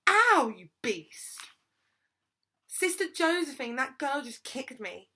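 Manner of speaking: loud, high outraged scream, dragged out. A narrator's reading. Kicked girl telling on her attacker to the nun, screaming. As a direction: scream it out as a hurt tattletale. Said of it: "she screamed".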